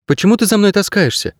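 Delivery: put-on high, insistent young heroine; questioning girl. A narrator's reading. Girl asking why the dog follows her, questioning.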